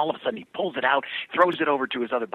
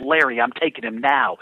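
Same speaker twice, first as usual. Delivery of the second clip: raised, louder radio call-out; mock yell to a teammate mid-race; retold yell; 8 dB louder than usual. Driver calling out to Larry on the track, mock yell. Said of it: "I'm gonna yell out".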